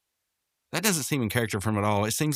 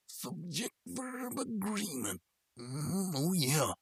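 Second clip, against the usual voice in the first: very soft, high, drawn-out croon; playful aside; 9 semitones higher, 9 dB quieter, much slower than usual. Pretend. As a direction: stretch it out slowly in a tiny high voice. Playful repeat of grammar self-correction.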